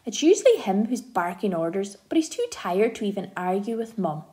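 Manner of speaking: ordinary delivery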